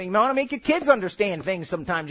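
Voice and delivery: ordinary talk, nothing marked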